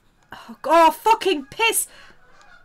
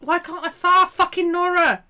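frustrated shout; 9 semitones higher, 7 dB louder than usual. Real, not acted. Frustrated outburst while drawing.

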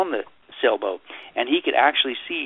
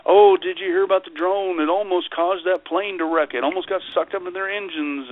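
mock alarm; 6 semitones higher than usual. Parodying alarmist news chatter.

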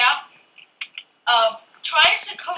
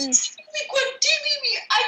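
panicked high plea; 12 semitones higher, 3 dB quieter, faster than usual. Real, not acted. Panicking, begging teleport.

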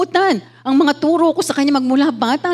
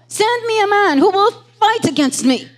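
retold shout; 7 semitones higher than usual. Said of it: "si Goliath nagsisigaw".

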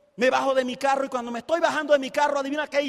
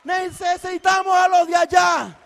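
cierre gritado, más fuerte; llama a todos con fuerza; llamado gritado; 7 dB louder than usual. Real, not acted. Llamado final. (a la gente de allá, gritando el llamado)

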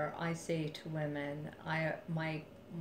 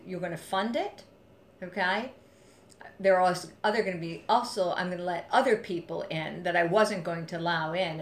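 laying down the law; 7 dB louder than usual. Retold or imitated. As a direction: dictate terms, no room to argue. Laying down terms to the bully.

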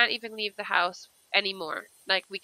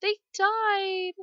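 high, whiny mock grief; gasp, then wail it slowly; sad; 9 semitones higher, 6 dB louder, much slower than usual. Gamer finds Sims dead in family tree, high mock-tearful wail. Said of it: "This is so sad".

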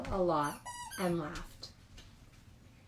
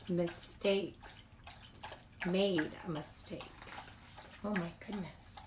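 hushed; 3 semitones lower, 10 dB quieter than usual. A narrator's reading. Stunned narration softens.

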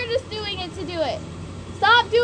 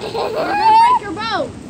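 alarmed warning; 13 semitones higher, 9 dB louder than usual. Real, not acted. Warns the child in alarm.

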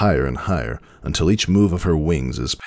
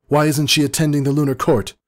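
high, louder desperate yell; frantic yell; 12 semitones higher, 4 dB louder than usual. A narrator's reading. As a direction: keep shouting at him, frantic. Celestia still yelling at stallion.

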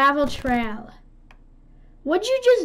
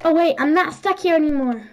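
normal talking voice

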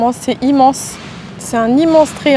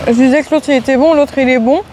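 eating a rice ball; chewing while talking; faster than usual. Real, not acted. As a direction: mouth partly full. Seen 1: bites onigiri at -1.0, keeps it at mouth while talking.

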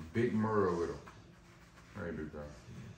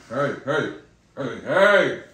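loud, firm "hey, hey" to stop the dog; sharp call to the dog; 8 dB louder, much slower than usual. Real, not acted. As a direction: snap the dog out of jumping. Scolding a jumping dog.

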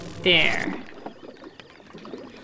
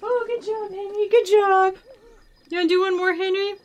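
high sing-song voice for a pet; talking to a dog; 11 semitones higher than usual. Real, not acted. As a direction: coo at the dog. Talking to her dog Henry.

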